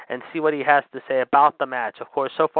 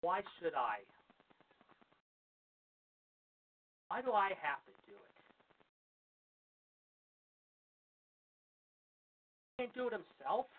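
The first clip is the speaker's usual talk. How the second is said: distant and faint, away from the mic; griping on the phone across the room; off-mic phone call; 5 semitones higher, 17 dB quieter than usual. Real, not acted. Host steps away, phone argument.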